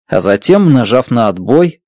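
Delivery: ordinary delivery, nothing marked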